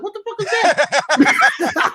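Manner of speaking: high, slow startled cry; mock alarm at a sudden crash; startled what the. Retelling hearing his car window smashed, startled.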